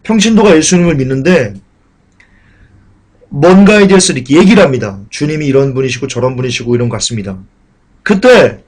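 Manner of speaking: ordinary delivery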